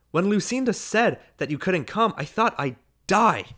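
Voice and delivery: high, loud gushing that eases down; screaming hostess, easing off. A narrator's reading. Hostess gushes to Ned over the crowd, half screaming. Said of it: "she began to scream".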